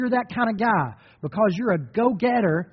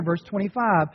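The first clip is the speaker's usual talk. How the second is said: normal talking voice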